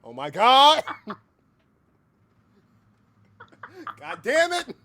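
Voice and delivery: high, loud laughing cry; real laughing outburst; laughing exclamation. Real, not acted. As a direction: crack up while cursing.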